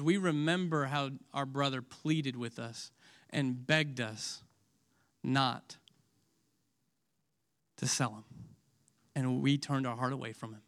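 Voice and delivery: hushed, low confession of guilt; remorseful confession. Retold or imitated. Judah confesses to his brothers, hushed and remorseful. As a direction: confess the old betrayal quietly. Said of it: "it's a very emotional scene".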